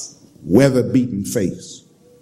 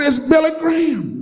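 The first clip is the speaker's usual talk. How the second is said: excited shout. Retold or imitated. Attendant announces the famous preacher.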